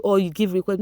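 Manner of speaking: ordinary talk, nothing marked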